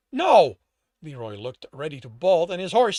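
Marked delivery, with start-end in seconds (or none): 0.1-0.6 high, loud, falling no; panicked denial. A narrator's reading. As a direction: deny it in alarm. Underling denies the accusation.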